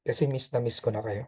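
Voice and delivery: a hint of a lonely child; soft sad confession. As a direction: let it fall away, hurt but loving. Child confessing missing father.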